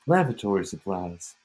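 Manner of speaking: ordinary delivery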